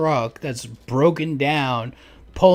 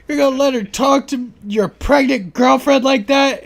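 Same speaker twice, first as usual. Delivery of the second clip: mocking shrill woman; 12 semitones higher, 8 dB louder than usual. Retelling neighbors' yard fight.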